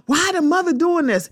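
high incredulous mimic of the crowd; mock outraged quote. Vlogger mimicking people who blame the mother, high mock outrage. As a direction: pitch it up, mimicking their fake shock.

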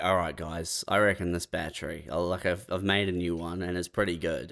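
low, soft, understated mock pitch; imagined low-key pitch. Imagined Elon pitches a battery to engineers, low-key. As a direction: pitch it quietly and low, playfully imitating.

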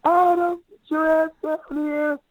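high-pitched yell, imitating a screaming mother; retold scream. TV mom calls Adam down to his visiting friend, mock scream. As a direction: yell it way up high as if summoning a kid. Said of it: "he just screams".

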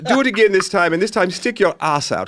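high and loud, crude demands; pushy English director. Retold or imitated. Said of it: "there is a director from England".

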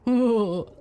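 loud high burst of giggling; a big beast who can't help giggling; monster giggle. A narrator's reading. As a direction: ticklish squid giggle. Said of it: "the giant squid started to giggle".

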